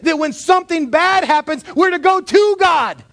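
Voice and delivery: loud, high, fervent preaching; shouted pulpit preaching; full-force preaching. Acted. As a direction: preach it out with rising fire.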